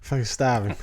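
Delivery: Jersey "stahvin" with dropped r; Jersey accent. Acted. Jersey native echoing the local answer, Jersey accent. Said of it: "In Jersey".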